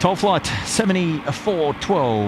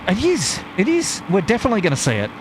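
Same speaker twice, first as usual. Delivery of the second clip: rising excited exclamation; thrilled it's coming into view; 6 semitones higher, faster than usual. Real, not acted. Catching the plane in view.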